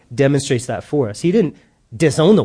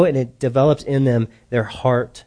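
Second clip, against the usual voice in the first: ordinary delivery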